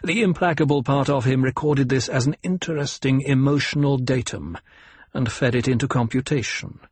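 ordinary delivery, nothing marked

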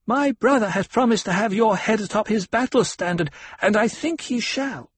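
put-on high, gleeful child voice; a little girl relishing his fear; young girl voice. A narrator's reading. Alia voiced by narrator.

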